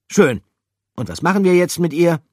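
high, loud, fast question; high, loud, fast Pikandou; quick question. Acted. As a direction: rush the question out.

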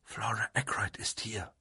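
unvoiced, urgent whisper; excited whisper. A narrator's reading. Caroline announces a visitor at home, excited whisper. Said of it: "meldete sie in erregtem Flüsterton".

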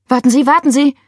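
loud, high desperate call; urgent plea. A narrator's reading. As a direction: stop them from hanging up. Begging operator not to hang up.

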